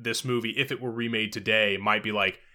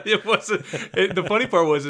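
higher, laughing through the words; laughing while talking; 8 semitones higher, 4 dB louder than usual. Real, not acted. Podcaster amused by co-host's joke, laughing. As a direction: talk through real laughter.

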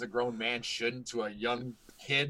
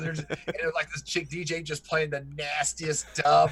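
ordinary talk

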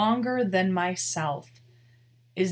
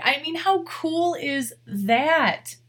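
getting emotional; 7 semitones higher, 4 dB louder, slower than usual. Real, not acted. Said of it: "I don't mean to get all emotional on you".

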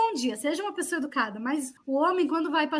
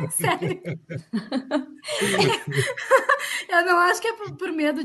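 laughing; 4 semitones higher, 4 dB louder than usual. Real, not acted. Host answering a jab about her city, laughing. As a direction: still laughing, a bit higher and louder.

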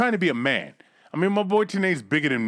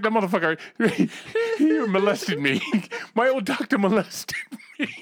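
higher, laughing through the words; laughing outrage; 5 semitones higher than usual. Retold or imitated. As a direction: laugh while crying foul about the old doctor. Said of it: "He's like".

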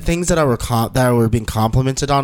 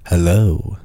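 lower, smooth show-off voice; deep nice voice; 7 semitones lower, slower than usual. Shown as a demonstration. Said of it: "have a nice voice".

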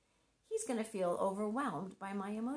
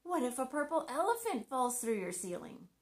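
higher and louder, a teasing sing-song; playful mock-dramatic what if; playful silly question; 7 semitones higher, 5 dB louder than usual. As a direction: pop the question brightly to break the fear. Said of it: "I would say".

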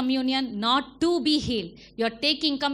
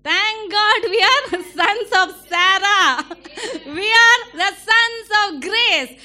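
laughing through the words, louder and higher; joyful laughing; 7 semitones higher, 9 dB louder than usual. Real, not acted. Preacher rejoices to his congregation, laughing loudly. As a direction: celebrate with a laugh in the voice.

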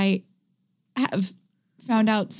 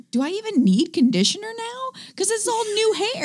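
higher pitched inner-voice quote; high quoted voice; 14 semitones higher than usual. Retold or imitated. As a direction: toss it up high, wondering. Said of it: "I also was like".

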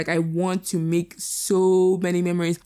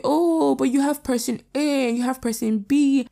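imitating well-meaning objectors, higher-pitched; higher mock-reassuring objection; mock protesting; 7 semitones higher than usual. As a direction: protest like a friend correcting someone, pitched up. Acts out people countering her feelings.

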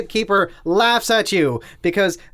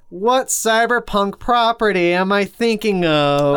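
Gatekeeper; 4 semitones higher, 3 dB louder, slower than usual. Acted. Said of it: "the Gatekeeper looks at you and says".

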